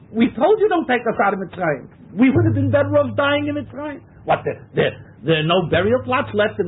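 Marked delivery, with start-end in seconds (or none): 0.1-4.0 higher, whining complaint about Egypt. Acted. Teacher mocks Israelites longing for Egypt, higher whine. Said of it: "they're looking back to Mitzrayim".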